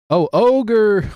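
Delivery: loud, high burst of realisation; sudden realisation. Real, not acted. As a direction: blurt it out as the answer dawns. Suddenly gets the crossword answer.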